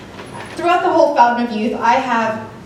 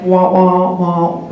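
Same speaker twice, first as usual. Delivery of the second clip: low wordless wah-wah mimicry; Charlie Brown-style muffled talk; 5 semitones lower, 3 dB louder, much slower than usual. Guys drowned out by bar noise, low cartoon wah-wah. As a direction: imitate garbled cartoon adult speech, pitched low. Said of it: "Charlie Brown style".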